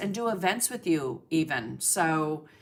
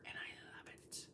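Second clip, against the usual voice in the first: whispered; 23 dB quieter than usual. Real, not acted. Trailing aside about the shows.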